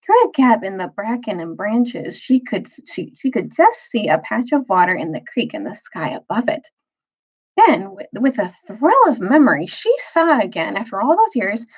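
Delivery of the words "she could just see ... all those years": ordinary delivery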